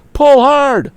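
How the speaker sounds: high, loud urgent cry. A narrator's reading. Tom urging the woman to pull, high loud cry. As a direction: urge her with full voice. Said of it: "he cried again".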